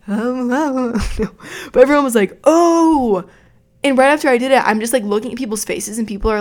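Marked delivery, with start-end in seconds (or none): 2.4-3.2 high mock gasp; a crowd's stunned oh; imitated shocked gasp. Everyone reacting.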